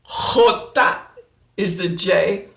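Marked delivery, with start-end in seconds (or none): 0.1-1.1 jota with a rough throat-cleared h; throaty jota. Shown as a demonstration. Teacher demonstrating jota again. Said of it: "clear the throat".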